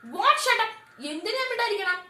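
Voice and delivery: high loud defiant shout; angry shout. Acted. Nomita defying Ajit's order, angry shout. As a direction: throw his words back at him. Wife shouting back at husband.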